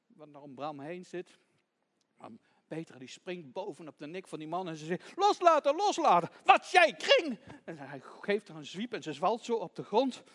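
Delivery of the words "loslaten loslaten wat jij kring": high, loud yells of let go; fierce shouting. Acted. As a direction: shout frantically while fighting him off. Child attacks man to free lamb.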